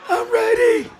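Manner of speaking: high, squeaky fake-confident announcement; mock brave. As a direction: pipe it up like a nervous kid pretending.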